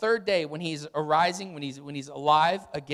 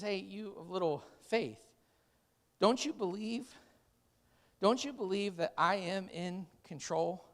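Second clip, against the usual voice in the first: gentle rebuke; 8 dB quieter than usual. Acted. Jesus gently rebuking disciples.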